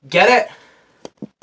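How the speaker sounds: ordinary talk, nothing marked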